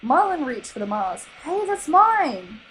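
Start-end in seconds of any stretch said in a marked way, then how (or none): 1.4-2.6 high, slow, louder protest; indignant high shout. A narrator's reading. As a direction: claim it back loudly. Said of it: "Dory shouted".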